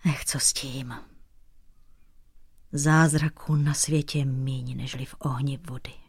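put-on very soft, broken old king, near whisper; tearful despair. A narrator's reading. Bent old king rejects the painter's comfort, tearful despair. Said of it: "vykřikl plačtivě schrbený sivý král".

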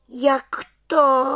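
high, hurt question; taken aback and stung; offended. Acted. Little witch asks the head witch, high and offended. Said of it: "Dotčeně se zeptala".

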